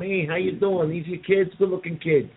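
high, fast-talking wiseguy greeting; Joe Pesci type. Retold or imitated. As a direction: mobster charm, Brooklyn style. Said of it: "the Joe Pesci type going".

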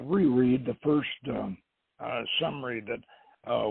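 ordinary delivery

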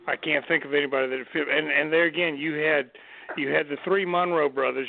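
usual speaking voice

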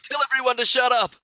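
mock exasperated yell. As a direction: pitch it up like a playful holler. Acted wish for movie line.

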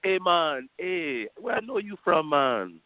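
high, bouncy mock UK Jamaican accent, teasing. Teasing a friend about his UK trip, mock UK Jamaican accent. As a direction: playfully put on a UK Jamaican accent. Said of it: "you gonna be like a U K Jamaican".

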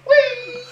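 high gleeful whee with a laugh; needle flying whee. Retold or imitated. Host mimics the needle flying away, high laughing whee. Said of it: "her needle went".

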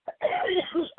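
hard dry coughing; coughing fit. Real, not acted. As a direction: cough from a sore throat. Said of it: "I'm coughing so much".

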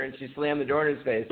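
ordinary talk, nothing marked

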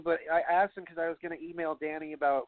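ordinary talk, nothing marked

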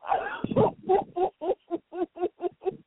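long high-pitched peal of laughter. Real, not acted. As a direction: laugh helplessly, high and drawn out. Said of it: "That's why I'm laughing".

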